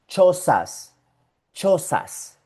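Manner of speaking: "chozas" with crisp tch; ch sound. Shown as a demonstration. Teacher models ch in Spanish words. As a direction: hit the ch in "chozas". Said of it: "vivían en".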